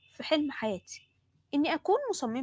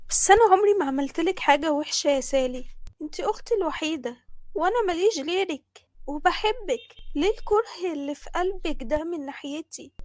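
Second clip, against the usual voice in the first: higher-pitched, wounded appeal; hurt pleading; 5 semitones higher, 4 dB louder than usual. Acted. Younger sister answering her sister's hatred, hurt and pleading.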